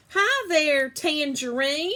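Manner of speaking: high, loud, drawn-out teasing hello; jokey greeting. A narrator's reading. Mother's boyfriend teasing Clementine in the elevator, jokey. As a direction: greet her like a joking grown-up. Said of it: "Alan said".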